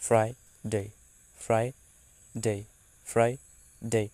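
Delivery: ফ্রাইডে said slowly and clearly, falling; careful model pronunciation. Shown as a demonstration. Teacher calmly modelling ফ্রাইডে for learners. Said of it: "এটার উচ্চারণ তো কঠিন না".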